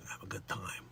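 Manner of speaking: Dick Facce's line whispered, low and soft. Pretend. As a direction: whisper it like a Bronx wiseguy. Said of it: "Dick Facce, at the very end there".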